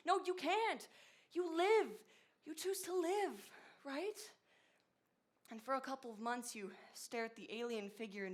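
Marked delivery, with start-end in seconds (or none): 0.1-4.2 higher and louder insistence; impassioned. Real, not acted. Host insisting you have to choose to live. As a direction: drive it home with conviction. Impassioned point about marriage.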